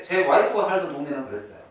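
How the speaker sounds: ordinary talk, nothing marked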